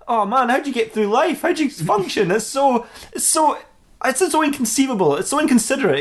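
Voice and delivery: high-pitched mock exasperation; exasperated disbelief. [name] can't believe anyone has that name, exasperated.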